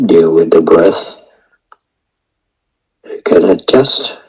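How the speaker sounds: ordinary talk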